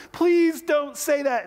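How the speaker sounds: put-on high, drawn-out loud child's plea; pleading yell. Son begging dad to stop, stretched high yell. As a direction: stretch the please into a high wail. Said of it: "yelled back".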